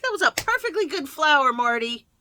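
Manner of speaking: high, loud, playful telling-off; mock scolding yell. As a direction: yell indignantly at a store robot. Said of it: "I yelled at the robot".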